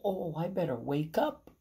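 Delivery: plain reading voice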